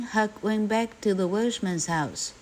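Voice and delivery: ordinary delivery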